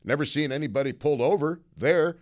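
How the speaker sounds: ordinary talk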